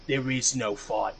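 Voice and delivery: slow, mock-grave finish of the hero line; dramatic movie hero. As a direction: drag it out like a cheesy trailer. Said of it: "interrupts the meeting very dramatically".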